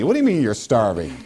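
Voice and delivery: ordinary delivery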